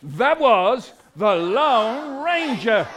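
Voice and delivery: loud, slow, stretched-out reveal; big dramatic answer; grand announcement. Acted. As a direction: proclaim it slowly, drawing out each word. Rehearsed grand reply with children.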